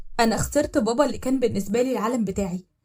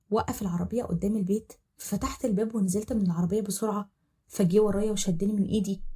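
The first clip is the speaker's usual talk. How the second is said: ordinary delivery, nothing marked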